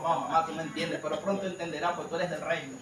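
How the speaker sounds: low, hushed aside to mother; soft placating reply. Retold or imitated. Preacher retelling his answer to his mother, soft placating reply. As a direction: drop down and reassure quietly.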